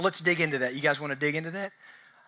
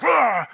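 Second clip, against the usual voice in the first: loud, raised mock dog roar; imitated dog growl; 5 semitones higher, 6 dB louder than usual. Preacher imitating the dog knocking a child over, growling. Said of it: "and making this girl".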